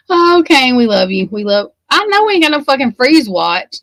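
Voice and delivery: high, loud cheer at the screen; gushing love for Kane, loud and high; excited cheering. Real, not acted. Watching Kane, she shouts her love at him excitedly.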